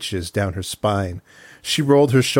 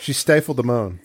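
normal talking voice

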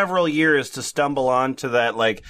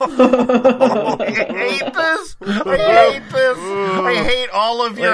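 helpless laughter through the words; laughing too hard to talk, half crying; hysterical laugh; 8 semitones higher, 5 dB louder, much slower than usual. Real, not acted. Laughing at the absurd meme post.

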